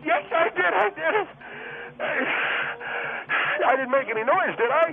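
high, strained, gasping protest; pained insistence. Acted. Guest insisting to a doubter he did it, in pain. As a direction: insist shrilly through the agony.

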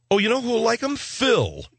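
high-pitched mock hostess voice; imitated hostess. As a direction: chirp it high, then drop on the name. Acting out the brunch host.

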